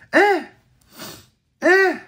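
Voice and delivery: high, loud, sharp; high, loud hein of shock at the card. Real, not acted.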